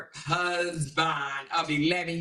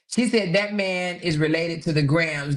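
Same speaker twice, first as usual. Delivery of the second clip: normal talking voice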